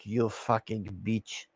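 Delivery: imitated low, quiet contempt; Melania. Commentator voicing Melania's look at the preacher, quiet contempt. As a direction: drop low and soft for an imagined silent insult. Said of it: "Melania's like".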